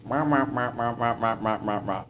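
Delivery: muffled wah-wah cartoon grown-up babble; Peanuts adult. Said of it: "the adults on Peanuts".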